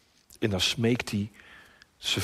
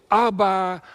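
raised, louder call; imitated cry out; 7 semitones higher, 6 dB louder than usual. Jesus crying to his father, imitated shout. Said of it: "Maar hij schreeuwt".

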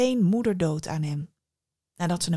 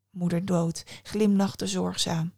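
ordinary delivery

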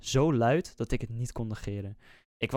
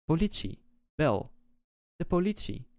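lightly mimicked parrot-like repetition, raised pitch; parroted words; 5 semitones higher than usual. A narrator's reading. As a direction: echo the mother's words like a parrot. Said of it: "alsof een papegaai de woorden voor haar herhaalde". (als een papegaai nagezegd)

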